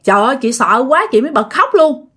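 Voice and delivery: ordinary delivery, nothing marked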